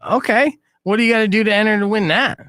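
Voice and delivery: normal talking voice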